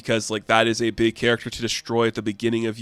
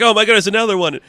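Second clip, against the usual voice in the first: higher, louder mock alarm; panicked surprise; 9 semitones higher, 7 dB louder, much faster than usual. Cliff Jumper sees Carly and yelps in loud, higher mock alarm. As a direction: yelp at the new human.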